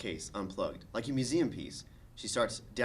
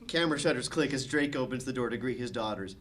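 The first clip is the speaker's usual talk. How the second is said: ordinary delivery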